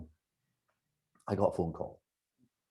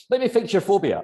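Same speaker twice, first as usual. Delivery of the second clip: much higher, louder cheerful insistence; hammed-up keen hypnotist to family; eager newbie; 11 semitones higher, 6 dB louder than usual. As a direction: pounce on a friend with an offer. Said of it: "You know".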